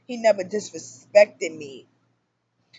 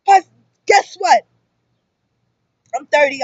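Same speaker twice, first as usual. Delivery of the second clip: ordinary talk, nothing marked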